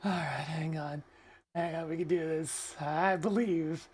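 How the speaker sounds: very soft, low self-encouragement; hushed, low pep talk to yourself; muttering to self. Real, not acted. Muttering to self while retrying.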